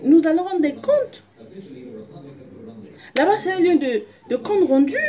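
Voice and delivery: high, loud fervent preaching. Acted. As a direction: preach it out with fire. Preaching at full force.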